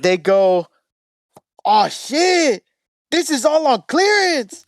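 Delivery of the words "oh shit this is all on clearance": higher, lightly voiced Chicano street accent; hammed-up excited Chicano homie. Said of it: "Yeah, Mexican".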